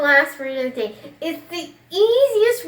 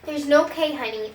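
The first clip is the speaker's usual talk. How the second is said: ordinary delivery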